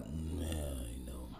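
slow, hushed mumble, mock shady guy; imitating the shifty boyfriend. Mocking how friend's man talks, shifty hush. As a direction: let it slide out slow and barely voiced. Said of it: "he talks like".

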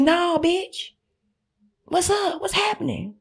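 soft, high, casual mock friend. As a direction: toss it off like a friend half listening. Friend's reply, acted quote.

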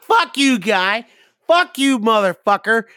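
high, loud acted yelling; defiant shout. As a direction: shout it as if back in the bar fight. Acted quarrel with the dad.

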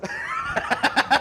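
loud high burst of laughter; real shrieking laugh. Real, not acted. Guest bursts out laughing at the accent joke. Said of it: "burst of laughter".

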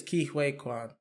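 "Ke Huy Quan" as KEE-HWAY-KWAN; corrected pronunciation. Shown as a demonstration. Answering the co-host's question, "Ke Huy Quan" said as KEE-HWAY-KWAN. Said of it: "Is that how you pronounce his name?".

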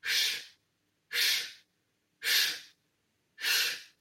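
chiados curtos e separados, sem voz; som de sh. Shown as a demonstration. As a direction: demonstre sh várias vezes, pausado. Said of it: "o S C H você faz". (chiados de “x” repetidos)

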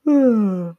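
high, loud drawn-out yawn. A narrator's reading. Sleepy sister after cleaning the kitchen, loud yawn. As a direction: let out a big yawn that falls away. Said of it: "She yawned loudly".

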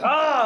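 high laughing yelp at the light; mock vampire shriek. Friend acting a vampire hit by light, laughing shriek. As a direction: shriek like daylight burns. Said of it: "I'm like".

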